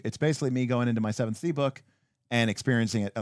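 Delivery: ordinary talk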